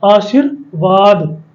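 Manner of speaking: आशीर्वाद very slowly, the र before व, aa-sheer-vaad; careful model word. Shown as a demonstration. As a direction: spell out आशीर्वाद slowly, syllable by syllable. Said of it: "इसे क्या पढ़ेंगे".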